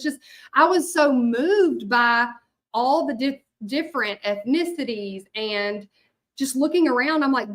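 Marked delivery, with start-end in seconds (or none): none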